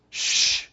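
whispered hiss to hush someone; sharp shushing. Acted. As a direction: shush him hard. Crowd hushing beggar.

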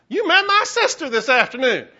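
high, loud and laughing, a chirpy church lady; mimicking the caller's phone greeting, high and loud; Glenda impersonation. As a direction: burst out high and bright, laughing as you say it. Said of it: "That was my Glenda impersonation".

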